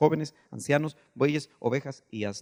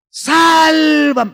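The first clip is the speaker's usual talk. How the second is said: high, long, full-voiced scream for help; Peter's desperate cry; 13 semitones higher, 16 dB louder than usual. Disciple crying to be saved in a storm, desperate shout. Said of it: "¿Cuál fue el grito de Pedro?".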